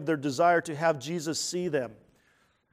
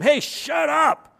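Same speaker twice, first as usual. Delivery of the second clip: loud, high, drawn-out telling-off; acted scolding yell, stretched words; rebuking shout; 9 semitones higher, 8 dB louder, slower than usual. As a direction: shout them down as an annoyed crowd. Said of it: "The crowd rebuked them".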